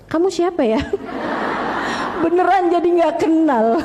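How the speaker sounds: amused, breaking into laughter; laughing while telling the joke; laughing through the punchline. Real, not acted. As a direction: giggle as the joke lands.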